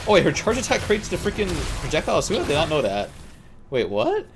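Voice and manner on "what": ordinary delivery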